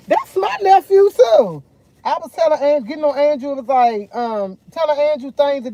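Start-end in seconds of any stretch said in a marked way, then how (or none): 0.1-1.6 high, loud and slow, protesting hard; indignant retort. Retold or imitated. Answering Tutu's accusation about the nephew, high and loud indignant. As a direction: insist he's your nephew too. Arguing back with Tutu over nephew.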